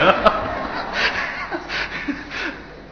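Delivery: high, breathy burst of laughter. Real, not acted. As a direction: laugh high at the ghost's scolding. Laughs after ghost's scolding. (高声笑出来)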